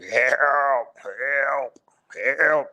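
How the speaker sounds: strained, drawn-out groggy call for help; sheepish, groggy cry. Retold or imitated. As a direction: imitate a weak voice struggling to breathe. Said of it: "sounded real sheepish and groggy".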